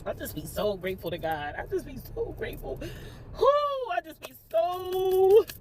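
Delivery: real crying, voice climbing high; tearful gratitude. Real, not acted. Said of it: "I will not stop crying".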